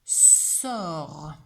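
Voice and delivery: "Sort" with a drawn-out s; dictated word. Shown as a demonstration. Teacher dictates a word to a pupil, "Sort" drawn out. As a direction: stretch the sounds of "Sort". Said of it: "il y a trois sons".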